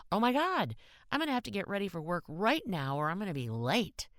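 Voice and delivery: high, startled rush at the clock; sudden alarm. Acted. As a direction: jump up in a panic about being late. Kathy suddenly sees the time.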